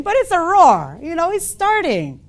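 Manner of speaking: ordinary delivery, nothing marked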